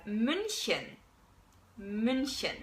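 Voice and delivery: slow München, hiss the ch; München with ich sound; German München. Shown as a demonstration.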